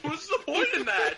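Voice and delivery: high, laughing question. Real, not acted. Laughing at resurrection idea.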